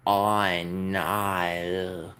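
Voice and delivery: very slow Australian "I know", vowels stretched; exaggerated Australian. Streamer hamming up an Australian accent for chat, drawn out. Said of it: "funnier to do an Australian accent, super exaggerated".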